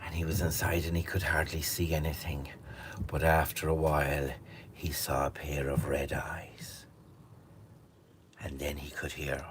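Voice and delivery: soft, whispered, slow storytelling; hushed suspense narration. A narrator's reading. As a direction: draw listeners into the dark cave. Tense cave scene told hushed.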